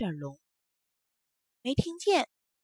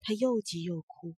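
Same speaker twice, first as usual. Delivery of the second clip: ordinary delivery, nothing marked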